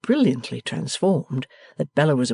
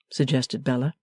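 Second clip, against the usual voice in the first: plain reading voice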